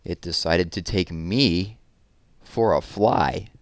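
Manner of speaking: lively picture-book read-aloud, big expressive swings; reading to children. A narrator's reading. Teacher reading a balloon story to children, animated storytelling. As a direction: read it like story time for little kids.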